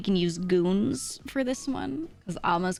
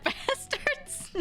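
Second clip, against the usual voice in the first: high, drawn out through laughter; laughing. Real, not acted. Suggesting a cursed fan name, laughing. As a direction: read it out slowly while cracking up.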